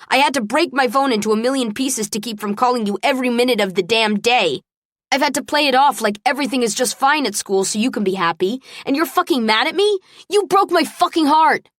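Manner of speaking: rising to an anguished shout; anguished outburst. Acted. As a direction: pour out the hurt until it breaks into a yell. Drunk Travis's anguished outburst.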